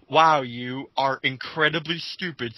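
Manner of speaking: ordinary talk, nothing marked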